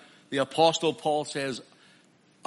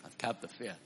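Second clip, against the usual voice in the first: soft echo; 5 semitones lower, 13 dB quieter, faster than usual. Acted. Quiet echo after proclamation.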